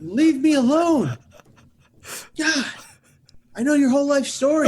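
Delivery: fed-up acted yell; exasperated outburst. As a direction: beg loudly for some peace. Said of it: "he goes like this".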